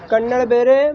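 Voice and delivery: normal talking voice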